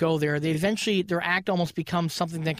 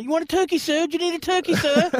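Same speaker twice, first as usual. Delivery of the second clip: put-on high, eager little-boy voice; Victorian street urchin; 10 semitones higher than usual. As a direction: pipe up like a Dickens urchin. Street urchin hawking turkey.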